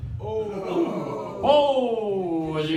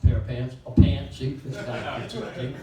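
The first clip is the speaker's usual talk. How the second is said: impression of pants; 5 semitones lower than usual. Shown as a demonstration.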